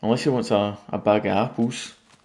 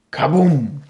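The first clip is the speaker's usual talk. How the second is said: loud, higher Kaboom; mock explosion; 5 semitones higher, 7 dB louder than usual. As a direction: shout it like a bursting creeper. Creeper explodes in retold scene.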